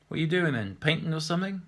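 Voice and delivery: put-on a chatty old chap poking his nose in; nosy old man. Old man interrupting the painter, lightly voiced and nosy.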